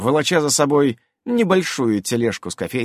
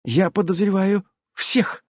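bristling; 8 semitones higher than usual. A narrator's reading. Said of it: "ощетинилась М".

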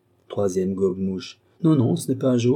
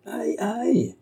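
high squeaky cry of pain; pained yelp; 12 semitones higher than usual. A narrator's reading. Woman crushed in the crowd, yelping in pain. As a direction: yelp as if your foot was crushed.

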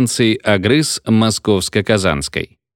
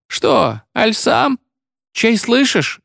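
much higher, hushed granny asking fearfully; high, worried old woman's question; anxious old woman; 13 semitones higher, slower than usual. A narrator's reading. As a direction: ask it like a scared old cleaner. Old woman asks, frightened.

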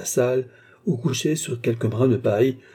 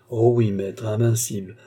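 plain reading voice

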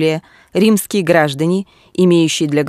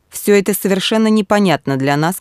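plain reading voice

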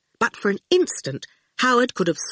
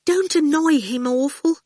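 faint squeak; 10 semitones higher than usual. Acted. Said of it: "in a faint squeak".

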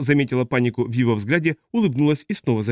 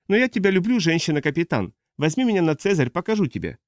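put-on high-pitched, eager old spacer; teasing old pilot; 5 semitones higher than usual. Old pilot begs the captain for a flight, high and playful.

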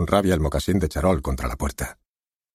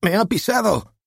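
high complaint; 14 semitones higher than usual. Acted. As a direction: complain with outrage. Corpse complains loudly.

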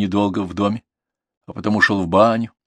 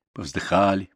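usual speaking voice

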